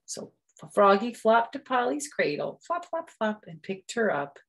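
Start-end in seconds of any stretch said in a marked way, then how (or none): 2.6-3.4 high flop sounds. A narrator's reading. Sound effect.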